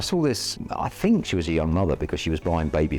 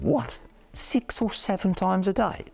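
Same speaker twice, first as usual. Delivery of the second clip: high, slow, disbelieving what; incredulous; 12 semitones higher, slower than usual. Retold or imitated. Coach recalling his disbelief at her coffee visits, incredulous. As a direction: stretch out the shock, pitched way up.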